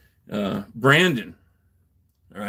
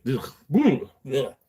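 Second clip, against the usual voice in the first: acted "ugh" of revulsion; grossed-out groans; disgusted shudder; slower than usual. Acting out disgust at nightmares.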